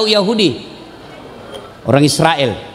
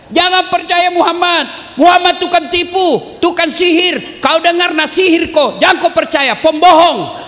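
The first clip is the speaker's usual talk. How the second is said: blind man shouting in the market; 11 semitones higher than usual. Retold or imitated. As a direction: rail at the crowd in a raised crier's voice. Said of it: "dia masih teriak-teriak".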